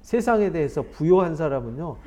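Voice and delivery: ordinary delivery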